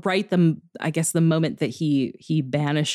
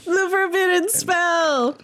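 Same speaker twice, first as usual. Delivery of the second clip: high, slow, grand mock echo of the name; hammed-up epic echo; dramatic announcer; 13 semitones higher, slower than usual. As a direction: intone it like an epic title.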